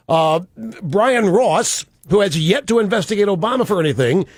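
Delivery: ordinary talk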